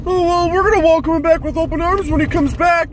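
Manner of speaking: sarcastic imitation of the GM welcoming him back; mocking Howie Roseman. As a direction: parody a smug, falsely gracious executive. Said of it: "Howie Roseman in his press conference is like".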